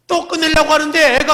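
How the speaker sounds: high, loud, slowed emphatic preaching. Real, not acted. Preacher rousing the congregation with a loud retelling. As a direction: push it out to the whole hall.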